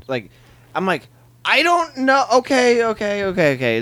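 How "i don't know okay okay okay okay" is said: high-pitched mock panic; flustered. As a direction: squeak it out, then settle through the okays. Acted flustered reply to girlfriend.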